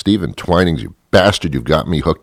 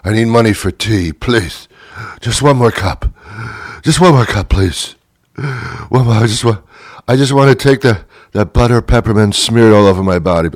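hammed-up beggar; 5 semitones higher than usual. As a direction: beg like a desperate tea addict on the curb, cracking higher as it goes. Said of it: "begging for money".

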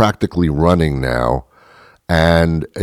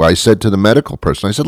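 ordinary talk

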